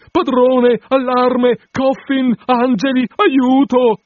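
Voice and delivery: high, loud, drawn-out shouting; panicked cry for help. A narrator's reading. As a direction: yell for the landlord as if for your life.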